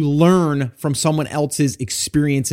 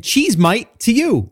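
higher-pitched imitated New Zealand accent; Kiwi accent; 8 semitones higher, 3 dB louder, slower than usual. Host returning the caller's sign-off, imitated Kiwi accent. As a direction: playfully echo a Kiwi "cheese mite". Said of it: "got the New Zealand accent down pat".

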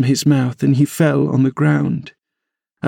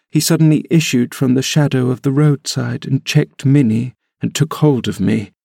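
usual speaking voice

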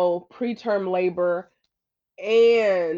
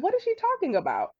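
very high, baffled retold question; oblivious confusion; faster than usual. New mom oblivious to the cake mix-up, mock baffled. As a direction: squeak it out as if totally clueless, then fall. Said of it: "I was so oblivious to it".

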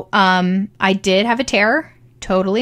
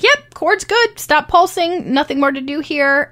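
matter-of-fact midwife quote; 7 semitones higher, slower than usual. Retold or imitated. Midwife checking the cord for the new mother, matter-of-fact. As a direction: lightly imitate a midwife's all-clear. Retelling midwife's brisk all-clear.

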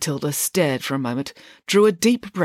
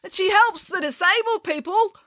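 high, eager call from the crowd; raised, high-pitched voice. A narrator's reading. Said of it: "called out someone else".

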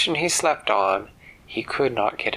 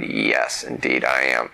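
put-on deep old man voice; 12 semitones lower than usual. Ted answers in low voice.

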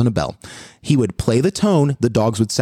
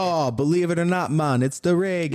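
put-on higher-pitched, playful Jamaican accent; mock Jamaican accent; 4 semitones higher than usual. Co-host answers which music dogs like, mock Jamaican accent. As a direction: put on a joking Jamaican lilt.

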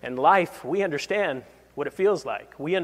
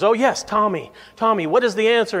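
relieved, higher and louder; relieved pastor; 5 semitones higher, 5 dB louder than usual. Retold or imitated. Pastor finally hearing a raised hand, relieved and eager. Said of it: "the pastor's relieved".

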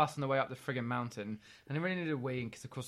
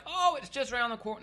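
breezy local; 9 semitones higher, 4 dB louder than usual. Retold or imitated. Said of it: "And they're like".

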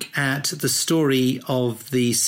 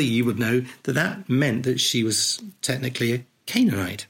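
usual speaking voice

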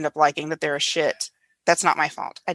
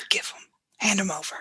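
whispering; 6 dB quieter than usual. Pretend. Joking demand for stories.

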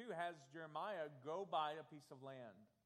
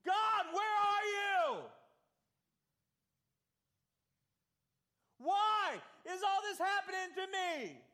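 anguished cry to God; 12 dB louder than usual. Retold or imitated. Preacher voicing the prophet's desperate plea, crying out loudly. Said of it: "I'll try to think what he was thinking".

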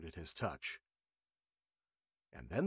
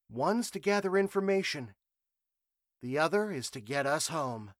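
higher, clear reasoning voice; sharp young reporter; 7 semitones higher, 4 dB louder than usual. A narrator's reading. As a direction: lay out the logic plainly. Emily voiced, calm reasoning.

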